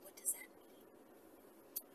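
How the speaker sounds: slow, hushed whispered question; whispered aside. Real, not acted. Reader whispering an aside to the audience, slow and hushed. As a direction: lean in and whisper it to the listeners. Aside to listeners mid-story.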